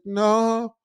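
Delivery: put-on high, lost girl's no; dazed high no. Tripping girl answers passers-by in a high, dazed voice. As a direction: answer lost and far away.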